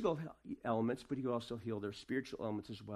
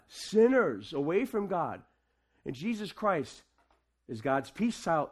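raised, ringing sermon voice; preaching; 5 dB louder than usual. Acted. Pastor preaching to the church at Christmas, ringing and raised. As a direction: preach it out to the congregation.

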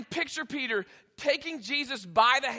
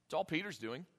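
normal talking voice

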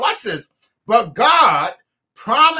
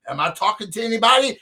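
normal talking voice

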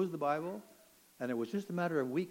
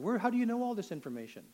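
high-pitched, mildly raised demand; retold yell; 8 semitones higher, 4 dB louder than usual. Inmate questioning the end-times talker, half-yelled high demand. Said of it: "I yelled at him".

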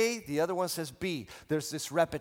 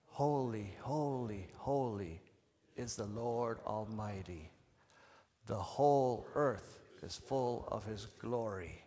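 low, hushed, reverent chant; soft voice; 5 semitones lower, 5 dB quieter, slower than usual. Shown as a demonstration. Preacher leading both sides the first time, soft voice. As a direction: say it softly and slowly together. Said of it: "say it in a soft voice".

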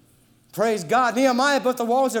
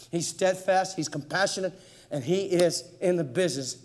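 ordinary delivery

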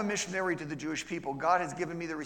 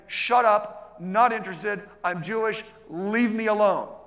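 louder and higher, mock angry snap; angry brush-off; 6 semitones higher, 9 dB louder, slower than usual. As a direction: shout them off, slowing at the end. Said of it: "And I said".

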